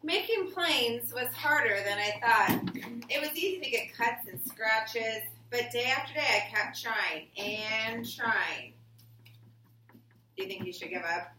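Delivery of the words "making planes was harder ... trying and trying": slow, expressive storybook reading; reading to children. Acted. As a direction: read it to the class like a picture book. Reading picture book aloud.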